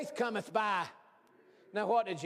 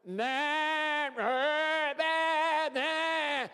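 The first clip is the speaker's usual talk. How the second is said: higher bleating nah, hammed up like a flock; sheep hollering; 7 semitones higher, 4 dB louder, much slower than usual. Flock on the hilltop bleating down at the valley, hollering. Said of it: "two hundred and fifty sheep hollering".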